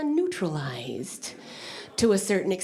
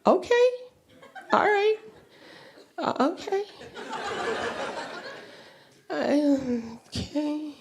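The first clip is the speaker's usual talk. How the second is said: nervous agreement; 5 semitones higher than usual. Real, not acted. Nervously accepting role.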